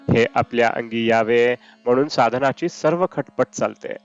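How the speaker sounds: normal talking voice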